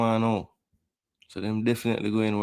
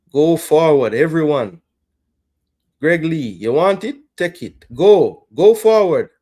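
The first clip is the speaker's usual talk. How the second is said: loud, high shouts of urging; real fan shouting go; yelling at the team; 4 semitones higher, 10 dB louder than usual. Real, not acted. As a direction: push them forward at the screen.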